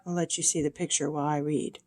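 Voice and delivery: ordinary delivery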